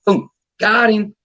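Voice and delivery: high, drawn-out catch announcement; retold triumph. Daughter casts back and hooks another, retold triumph.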